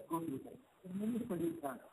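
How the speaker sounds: suddenly very faint, far off; barely audible. Real, not acted. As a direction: voice fading away from the mic. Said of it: "your voice is very low all of a sudden".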